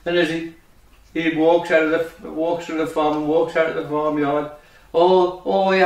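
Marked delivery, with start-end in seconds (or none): none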